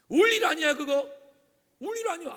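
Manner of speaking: high, loud acted rebuke; dismissive scolding. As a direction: shout down the weeping as needless. Acted rebuke of needless weeping. (큰소리로 나무라듯)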